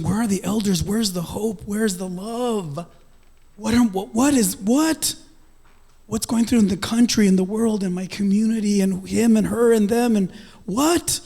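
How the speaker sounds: asking everyone where hope went; lightly voiced lost seeker; desperate searching. Retold desperate searching questions.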